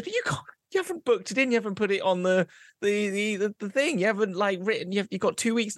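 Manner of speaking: high-pitched stumbling objection; flustered boss. Acted. Boss objecting to an unbooked holiday, flustered high-pitched stammer. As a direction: protest while groping for the words.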